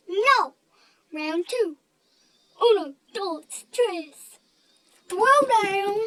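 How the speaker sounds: loud, counting slowly into a battle cry; rally for round two like a playground brawler; mock fight call. Friend restarts the fight.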